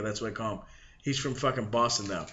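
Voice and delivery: ordinary talk, nothing marked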